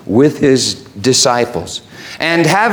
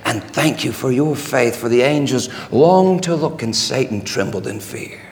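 low, soft, intimate reassurance; tender thanks; 4 semitones lower, 6 dB quieter than usual. Acted. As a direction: drop to a hushed low voice after the cry. Jesus tenderly thanks Vince.

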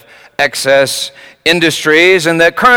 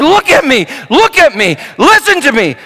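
urgent shout; 9 semitones higher than usual. Retold or imitated. Father shouts for his daughter's attention. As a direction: call out to make her look at you. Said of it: "So I screamed".

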